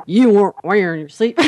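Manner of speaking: hammed-up Texan cop, breaking into laughter; Texas policeman. Storyteller mimicking the policeman for laughs, Texas accent. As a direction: do a joking Texas officer and crack up. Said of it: "That's how he sounded".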